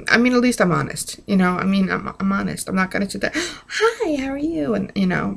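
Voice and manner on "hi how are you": high, sugary fake hello; fake sweet greeting. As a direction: chirp it like a fake-nice greeting. Mock fake-nice greeting.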